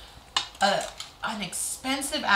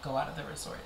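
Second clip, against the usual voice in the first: low, soft, offhand counter; casual dismissal; 4 semitones lower, 9 dB quieter, faster than usual. Real, not acted. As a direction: brush the warning aside quietly. Her own reply to the warning.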